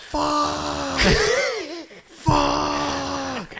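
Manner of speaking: long, high-pitched mock scream. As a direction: imitate a distant angler's drawn-out scream. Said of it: "he's screaming".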